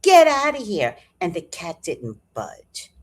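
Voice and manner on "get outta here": higher, louder shout to shoo the cat; John shouts at the street cat to go away. Retold or imitated. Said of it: "John went to tell the other cat".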